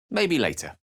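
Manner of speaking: usual speaking voice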